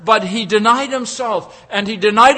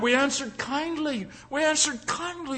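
softer, tender repeat; gentle; 5 semitones higher, 8 dB quieter than usual. Acted. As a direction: say kindly the way it means. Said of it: "repeats softly".

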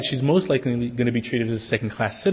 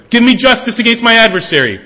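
loud plea; 7 semitones higher, 8 dB louder than usual. Acted. Widow pleading loudly.

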